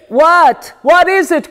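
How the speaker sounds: loud, startled demand; father crying out in alarm at the crash. Acted. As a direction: bark out the question in alarm. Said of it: "cries my father".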